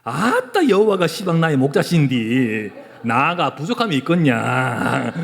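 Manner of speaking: loud, drawn-out Jeolla dialect. Shown as a demonstration. Preacher tries an unpractised dialect version on congregation, hammed-up Jeolla accent. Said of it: "전라도 버전도 조금 할 수 있겠더라고".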